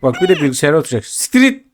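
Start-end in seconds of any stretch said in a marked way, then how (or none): 1.0-1.7 angry shout. Real, not acted. Karaktere kızıp bağırıyor.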